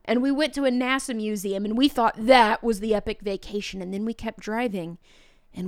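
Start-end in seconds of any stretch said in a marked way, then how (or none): none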